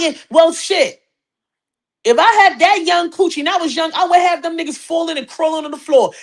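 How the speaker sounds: mock scolding.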